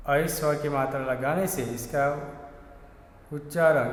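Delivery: ordinary talk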